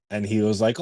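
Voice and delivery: ordinary talk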